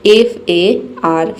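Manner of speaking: F A R spelled out very slowly, letter by letter; slow letter spelling. Shown as a demonstration. Teacher spells out F A R slowly to her students. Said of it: "हम एक word लेते हैं फार".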